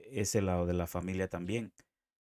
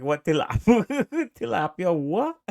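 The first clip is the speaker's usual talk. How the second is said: higher, laughing Jamaican-accent imitation; 10 semitones higher, 6 dB louder than usual. Retelling the chef clip, laughing Jamaican accent. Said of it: "he like".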